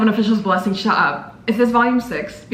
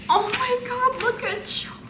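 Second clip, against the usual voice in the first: tiny high-pitched squeak, softening; overwhelmed gushing; 14 semitones higher, 5 dB quieter than usual. Real, not acted. Fan melting over Chopper on the cover, squeaking. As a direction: squeak it out softly, melting at the cuteness.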